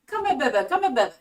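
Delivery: put-on high, loud, doting baby talk; a loving parent calling a little one over; tender coaxing. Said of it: "God's like".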